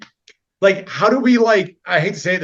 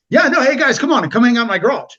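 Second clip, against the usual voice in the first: higher, louder, inviting call; mock friendly host; 8 semitones higher, 5 dB louder than usual. Friend inviting the guys over, higher and louder. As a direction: play the cheery garage host. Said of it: "You're just like".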